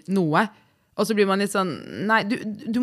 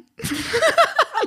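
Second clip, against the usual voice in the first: high squealing laugh; real flustered high laugh; embarrassed laugh. Real, not acted. Embarrassed at the topic.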